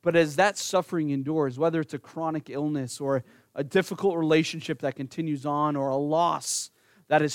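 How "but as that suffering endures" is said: ordinary talk, nothing marked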